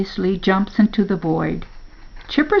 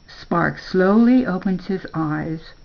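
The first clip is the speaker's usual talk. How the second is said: ordinary delivery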